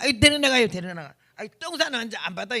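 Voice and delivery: mock irritated doctor turning someone away; imitated dismissive doctor; acted doctor's refusal. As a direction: refuse the patient brusquely, lightly acted.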